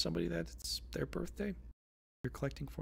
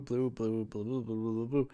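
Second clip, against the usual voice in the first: lower, rapid run of repeated words; playful babbling; 4 semitones lower than usual. Pretend. Reader spotting blue everywhere on the cards, playful burbling. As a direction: burble the word over and over.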